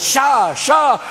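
high, sharp shushing cry; hushing the crowd. Acted. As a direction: cut the complainers off, high. Moses hushes the complaining crowd.

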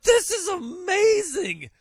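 high, slow, gushing mock amazement; high, drawn-out astonished fan voice; imagined amazement. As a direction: gush like a stunned fan. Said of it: "They'd be like".

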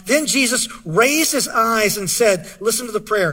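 ordinary talk

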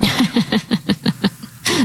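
real burst of helpless laughter; high, rippling laugh. Real, not acted. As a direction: crack up at the punchline. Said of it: "Sorry, I'm laughing".

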